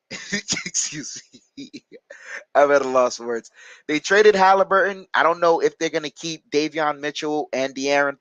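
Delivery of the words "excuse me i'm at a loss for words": laughing. Real, not acted. Laughing at Halliburton trade news.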